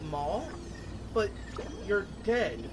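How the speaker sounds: usual speaking voice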